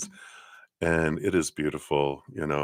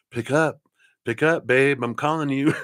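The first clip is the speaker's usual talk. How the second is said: playful husband voice; 7 semitones higher than usual. Retold or imitated. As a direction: tease it like a sweetheart urging her to answer. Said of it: "He's calling".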